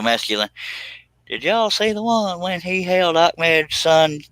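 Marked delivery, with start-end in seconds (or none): 1.3-4.2 high put-on voice. Puppet voice while describing.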